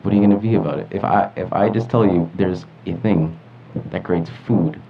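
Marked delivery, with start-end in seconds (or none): none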